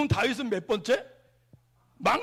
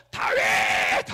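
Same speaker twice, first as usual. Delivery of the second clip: a slow, lower, breathy, louder shout of "다윗!"; kids yelling out; 13 semitones lower, 9 dB louder, much slower than usual. Retold or imitated. Sixteen hundred students shouting their answer as one. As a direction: bellow the answer like a packed hall. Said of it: "있는 실컷 외쳤대요".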